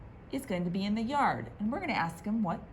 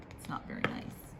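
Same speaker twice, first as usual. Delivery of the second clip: whispered aside; 9 dB quieter than usual. Real, not acted. Aside to children about teasing.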